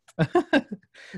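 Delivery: a short laugh that rises high; host laughing along with the guest. Real, not acted. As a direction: let a light laugh slip out.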